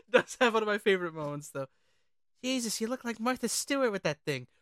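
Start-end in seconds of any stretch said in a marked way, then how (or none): none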